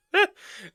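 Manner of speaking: brief high-pitched laugh; short high laugh. Real, not acted. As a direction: let out a quick high laugh. Laughs at having little to say.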